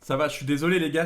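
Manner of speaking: normal talking voice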